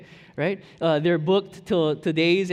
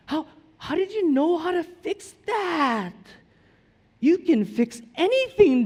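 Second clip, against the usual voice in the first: put-on very high, loud, slow child voice; imitating his kid; 11 semitones higher, 8 dB louder, slower than usual. Father voices his son praising his bike repair. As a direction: gush like an adoring little boy. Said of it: "he's like".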